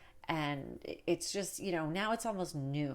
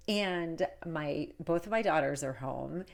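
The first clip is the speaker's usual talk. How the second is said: ordinary talk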